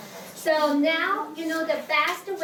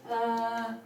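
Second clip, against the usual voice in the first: "Uh" low and level; low third tone; 3 semitones lower, 4 dB quieter than usual. Shown as a demonstration. Teacher repeating third tone, low. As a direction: settle "Uh" down at the bottom. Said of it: "That's third tone".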